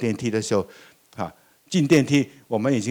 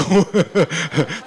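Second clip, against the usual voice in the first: hearty laugh; 4 semitones higher, 3 dB louder than usual. Real, not acted. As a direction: laugh out loud at the story. Laughing at the child's story. (哈哈大笑)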